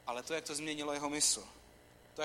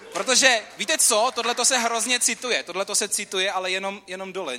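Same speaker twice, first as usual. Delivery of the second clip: much louder, higher excited preaching; rally the crowd, voice up; fired up; 6 semitones higher, 12 dB louder than usual. Real, not acted. Preacher urging the congregation to applaud, fired up and loud.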